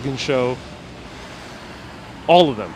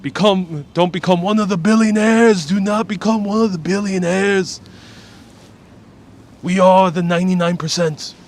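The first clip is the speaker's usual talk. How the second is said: loud, raised stump-speech voice; rally the crowd, loud and high; high, forceful old-school New York politician; Bernie Sanders impression; 5 semitones higher, 5 dB louder than usual. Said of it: "I wish I could do a Bernie accent".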